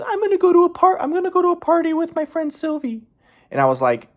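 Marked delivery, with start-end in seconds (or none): 0.0-3.0 very high put-on falsetto; mock girlfriend voice, high-pitched; imitating girlfriend. Girlfriend announcing a party plan, in mocking high falsetto. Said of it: "she was like".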